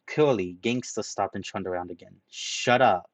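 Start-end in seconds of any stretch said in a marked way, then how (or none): 2.3-3.1 loud curt order; menacing. Acted. Gangster silencing Ranta, loud and curt. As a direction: snap it as a threat.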